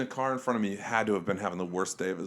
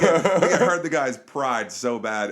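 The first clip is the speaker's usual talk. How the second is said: ordinary delivery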